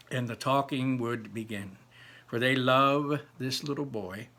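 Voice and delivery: lively storybook narration; reading to children. Acted. Teacher reading Charlie and the Chocolate Factory to pupils, storybook narration. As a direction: read it out to the class with energy. Reads the book aloud to class.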